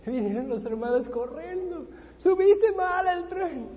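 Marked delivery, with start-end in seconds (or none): 2.2-3.7 high, loud, slow mock reproach; brothers' scolding. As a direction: tease him slowly about the mistake. Brothers tell him wrong train.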